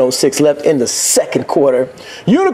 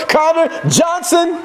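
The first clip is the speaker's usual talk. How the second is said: touchdown call; 7 semitones higher, slower than usual. Real, not acted. Calling a touchdown.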